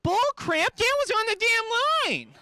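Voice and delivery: very high, squeaky furious shout; angry dough voice. Acted. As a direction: squeal it in outrage. Doughboy resumes argument.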